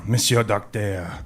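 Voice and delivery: put-on slow French-accented address; lightly acted French lady accent. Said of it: "The young French lady says".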